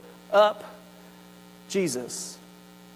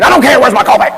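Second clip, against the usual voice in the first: grumpy; 2 semitones higher, 7 dB louder, faster than usual. Retold or imitated. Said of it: "Stacy will say".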